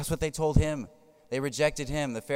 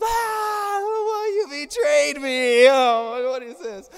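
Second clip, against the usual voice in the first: put-on baby's wail; 12 dB louder, much slower than usual. Baby getting his shots.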